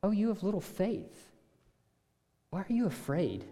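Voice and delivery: Jesus answering his frightened disciples, soft and calm; gentle reproach. Retold or imitated. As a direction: soothe them as you correct.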